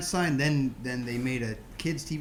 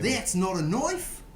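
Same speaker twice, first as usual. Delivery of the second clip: mock Aussie movie line; 5 semitones higher, slower than usual. Crocodile Dundee parody.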